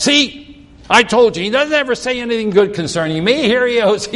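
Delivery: mock-indignant, high and quick complaint; mad king. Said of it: "king gets mad".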